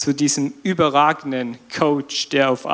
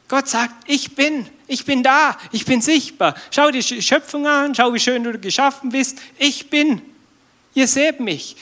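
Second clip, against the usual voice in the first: high, raised, proclaiming God's voice; voicing God; 7 semitones higher than usual. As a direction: announce it as God speaking to his people. Spricht als Gott, erhoben. (als Gott verkündend, hoch und lauter)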